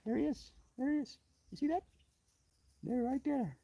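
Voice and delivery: soft, high pet-talk voice. Real, not acted. Showing the pet its caught flea, soft high cooing. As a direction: coo it softly up high.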